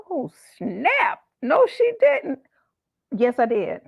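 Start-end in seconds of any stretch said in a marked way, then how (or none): 0.1-2.4 high, loud mock gasp of the office crowd; shocked onlookers. Coworkers reacting to her refusal, loud and high. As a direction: ham up the office gossip shock. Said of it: "And everybody was like".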